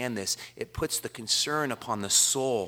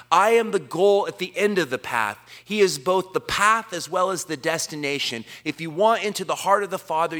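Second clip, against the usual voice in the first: louder, higher, climbing sermon voice; rising pulpit fervor, louder and higher; full-force preaching; 6 semitones higher, 5 dB louder than usual. Acted. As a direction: preach it out to the whole room, building.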